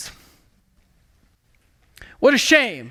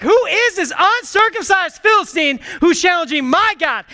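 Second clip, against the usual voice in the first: higher, shouted challenge; defiant shout; 5 semitones higher than usual. Retold or imitated. David calling out the Philistine, defiant shout. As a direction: yell it at the giant.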